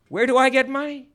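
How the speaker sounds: high, loud plea of poverty; indignant wail of having nothing; protesting victim. Acted. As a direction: protest loudly, pitch shooting up. Victim protests he has none.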